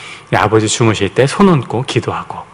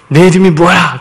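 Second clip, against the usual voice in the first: mock question to a son; 7 semitones higher, 5 dB louder than usual. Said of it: "아들이 아빠한테".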